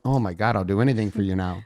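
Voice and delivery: hammed-up melting reply; swooning husband. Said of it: "I'll be like".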